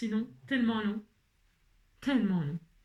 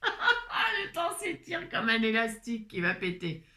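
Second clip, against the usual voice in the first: laughing. Real, not acted. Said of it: "repeats the line laughing".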